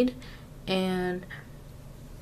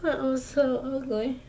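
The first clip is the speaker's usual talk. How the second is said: higher dismayed complaint; dismayed groan; 5 semitones higher than usual. Real, not acted. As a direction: cringe at the result. Dismayed at eyebrows.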